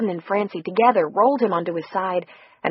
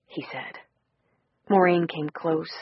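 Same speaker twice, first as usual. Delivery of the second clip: ordinary delivery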